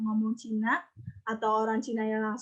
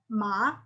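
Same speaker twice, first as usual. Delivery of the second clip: "ma" starting low, sweeping up at the end; second-tone MA; 3 semitones lower than usual. Shown as a demonstration. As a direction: lift "ma" upward like a question. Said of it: "Tapi ton dua".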